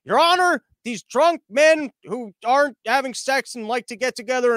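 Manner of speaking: high, loud courtroom plea; mock prosecutor. Lawyer mocking the case before judge, pompous plea. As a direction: plead the ridiculous case to the judge.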